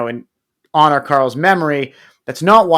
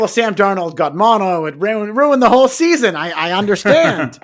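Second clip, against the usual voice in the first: rising, louder mock-justifying whine; imitating Larry; 7 semitones higher, 4 dB louder than usual. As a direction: play Larry excusing himself, higher and louder. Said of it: "he immediately wants to justify it".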